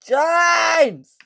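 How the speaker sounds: high, loud scream of a name. Acted. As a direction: shout the name in alarm. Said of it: "screams the Topham Hat".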